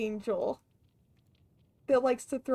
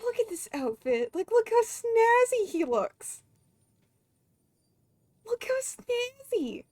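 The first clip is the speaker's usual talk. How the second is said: higher, delighted gushing; excited gush; 9 semitones higher than usual. Real, not acted. Streamer gushing to chat over a snazzy outfit. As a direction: squeal over how snazzy he looks.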